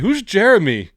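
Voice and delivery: mocking pupil. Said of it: "someone's like".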